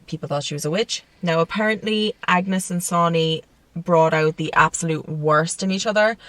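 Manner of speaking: usual speaking voice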